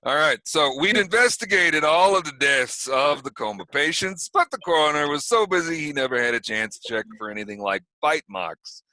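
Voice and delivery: put-on sheriff's official address in a New York accent. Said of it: "speaking in a New York accent".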